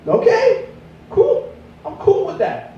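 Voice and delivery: mock-eager, squeaky-high compliance; high-pitched agreement. Acting his eager agreement with dealer.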